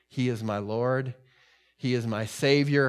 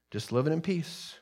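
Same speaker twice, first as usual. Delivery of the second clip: usual speaking voice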